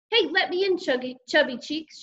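high opening, insistent taunt; demanding sister. A narrator's reading. Sister demanding to be let in, insistent. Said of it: "she demanded".

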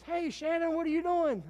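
high, loud surprised greeting across the room; old classmate calling out. Retold or imitated. Old classmate spots the pastor at Wendy's, loud high surprised hail. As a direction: hail an old friend you spot by surprise. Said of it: "He sees me, he goes".

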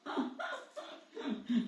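light airy laugh; a short soft chuckle. Real, not acted. Laughs after quoting father.

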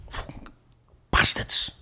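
acted whispered curse; paranoid whisper. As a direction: whisper it under your breath, retelling paranoia. Paranoid muttering about imagined police.

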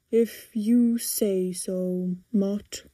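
very slow, put-on big man voice; giving in. A narrator's reading. Man giving in to Timothy, slowly. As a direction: draw each word out, resigned. Gives in after sighing, kisses head.

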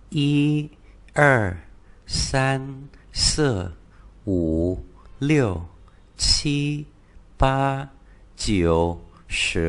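teacher reading the Chinese numbers slowly for repetition; Mandarin counting. Shown as a demonstration. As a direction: count one to ten in Mandarin tones, word by word. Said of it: "chúng ta đọc lại từ một đến mười".

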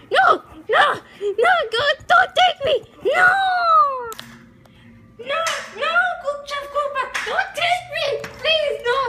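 high, loud desperate no's; panicked screaming. Acted. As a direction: scream no over and over like a scared kid. Mario refuses to go.

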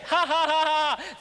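hammed-up belly laugh, ha by ha; mock laughter. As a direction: imitate a crowd bursting out laughing. Imitates audience bursting out laughing.

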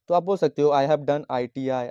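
ordinary delivery

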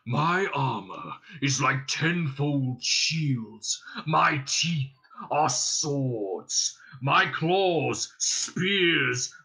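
slow, swelling dragon boast. Acted. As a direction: build each boast bigger toward the end. Dragon boasts of his might.

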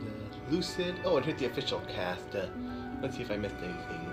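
usual speaking voice

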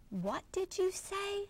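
mother gently asking her worried son; tender mother. Acted. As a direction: coax him softly to speak.

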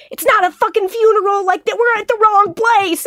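high-pitched frantic phone call; worked-up, high-voiced retelling; retold panicked outburst. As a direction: re-enact panicking to the newsroom.

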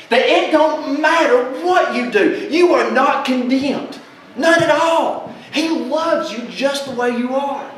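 exultant preacher; joyful preaching. Real, not acted. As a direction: rejoice over the good news.

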